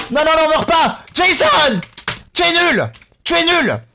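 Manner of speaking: high, mock-outraged scolding; exasperated yelling. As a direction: berate your hopeless game character loudly. Berates his dying game character.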